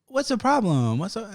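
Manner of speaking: high mock Vietnamese accent; [name]. Phone agent asks caller about problem, mock Vietnamese accent. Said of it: "that's exactly what she sounded like".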